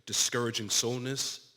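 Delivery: measured dramatic reading with long pauses; hushed on the tender lines, firmer on the urging; solemn letter reading. Acted. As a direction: read a father's last letter to his son, tenderly and deliberately. Reads a condemned father's letter aloud.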